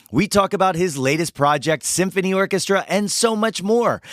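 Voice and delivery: usual speaking voice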